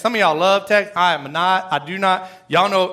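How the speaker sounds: ordinary delivery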